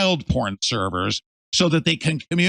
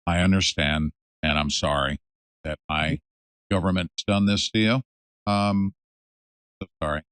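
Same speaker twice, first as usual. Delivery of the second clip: sincere apology; 9 semitones lower than usual. Real, not acted. Apologizing for government's treatment.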